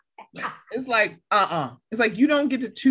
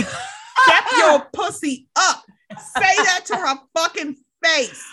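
high, loud, punched-out command; emphatic urging; 7 semitones higher, 4 dB louder than usual. Acted. Urging Heather to confront Jen, loud and high. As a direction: hammer each word at a high pitch.

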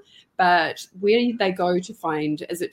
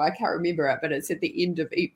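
ordinary talk, nothing marked

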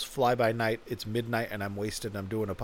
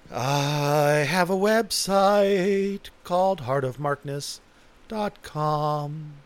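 slow, stretched, raised announcement; drawn-out plug; 4 semitones higher, 5 dB louder, much slower than usual. Pretend. Podcaster announcing his website, hammed-up and drawn out. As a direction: stretch the words out theatrically.

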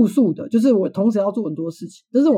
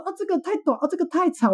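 fussy customer; 9 semitones higher, 4 dB quieter than usual. Retold or imitated. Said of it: "不然说".

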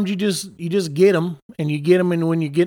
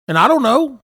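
crazy Cajun; 9 semitones higher, 6 dB louder than usual. Acted. [name] shifting into his crazy Cajun accent, higher. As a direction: slip into the Cajun accent, pitched up. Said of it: "sometimes I'm the crazy Cajun".

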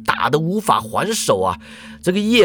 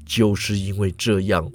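explaining professor; 7 semitones lower than usual. A narrator's reading. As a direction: lecture it low and unhurried. Professor gazing far.

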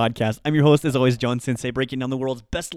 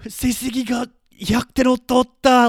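much higher, louder bragging friend; excited friend; 11 semitones higher, 5 dB louder than usual. Pretend. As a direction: boast about the test score in a high voice. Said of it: "You can respond with".

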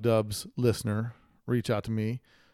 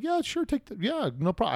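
much higher, breezy "no problem"; mock eager yes; 10 semitones higher than usual. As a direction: toss off a cheerful yes, sure. Retold eager agreement to couple.

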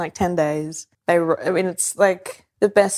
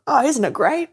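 naive delight; 8 semitones higher than usual. Retold or imitated. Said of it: "They're like".

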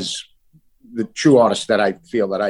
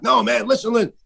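higher, urgent pleading; urgent plea; 12 semitones higher than usual. Retold or imitated. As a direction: beg them to just listen, pitched up. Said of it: "And I'm like".

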